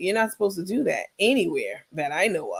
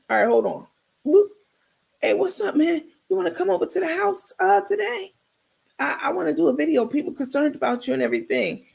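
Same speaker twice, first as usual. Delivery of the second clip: Carmen on the phone; 2 semitones higher than usual. Retold or imitated. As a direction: hurry him along with fake friendliness. Carmen voiced on phone call.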